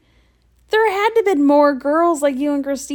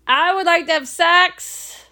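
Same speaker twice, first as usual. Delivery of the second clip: high, drawn-out mock announcement; mock proposition; 7 semitones higher, 3 dB louder, slower than usual. Friend mocking her blunt approach to men, high announcing voice. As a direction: call it out high, like a blunt greeting.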